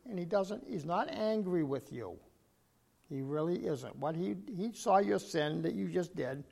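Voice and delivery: usual speaking voice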